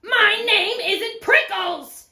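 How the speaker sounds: slow, high, loud retort; indignant yell. Acted. Cactus to teasing jackrabbit, yelling back. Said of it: "Hank yelled back".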